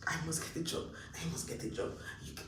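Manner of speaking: normal talking voice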